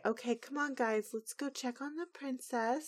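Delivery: hushed friends. Retold or imitated. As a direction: coax the friends away quietly. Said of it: "the friends say".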